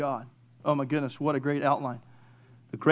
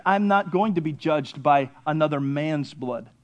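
ordinary talk, nothing marked

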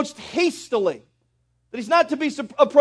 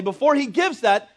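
usual speaking voice

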